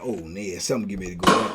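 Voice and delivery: low, hushed, half-muttered warning; hushed ominous aside. Retold or imitated. Storyteller's inner thought at the jail, low and hushed. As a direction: drop it low like trouble is coming. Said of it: "I'm like".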